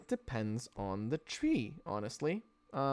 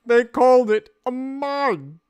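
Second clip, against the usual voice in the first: mock dramatic voice; 9 semitones higher, 13 dB louder than usual. Mocking voice of the phrase.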